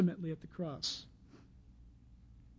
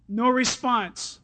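usual speaking voice

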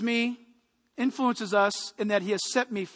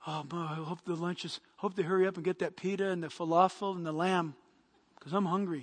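low, soft daydreaming about lunch; hungry Peter musing; 6 semitones lower, 8 dB quieter than usual. Acted. Peter lying on the roof waiting for lunch, hungry musing. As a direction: murmur it low to yourself, wishing for food. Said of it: "imagine he's laying there".

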